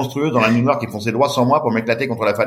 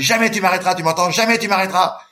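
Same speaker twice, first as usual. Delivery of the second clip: retold yell; 7 semitones higher than usual. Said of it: "je hurle dans la mer".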